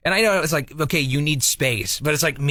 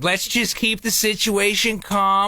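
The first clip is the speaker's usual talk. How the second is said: slow, higher, insistently soothing; calming retold; 4 semitones higher, much slower than usual. Husband defending what he told his wife, placating. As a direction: spell out the soothing words slowly.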